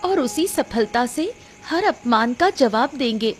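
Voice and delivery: steady your husband with wounded resolve; hurt, resolute wife. Acted. Narrator voices the wronged wife. (आहत पर दृढ़ पत्नी की आवाज़)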